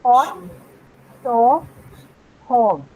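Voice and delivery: pot, saw, hog, each word said slowly and cleanly; teacher modelling vowels word by word; model vowel demo. Shown as a demonstration. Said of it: "so listen to this".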